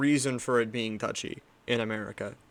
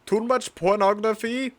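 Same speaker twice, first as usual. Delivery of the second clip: put-on high, loud Indian accent like a scam caller; 8 semitones higher, 5 dB louder, slower than usual. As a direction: play an Indian tech-support scammer, pitched up. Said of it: "that was Indian".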